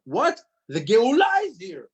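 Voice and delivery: high, loud burst of disbelief; astonished excitement. Acted. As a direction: exclaim it like sudden amazing news. Acts astonished realization of redemption.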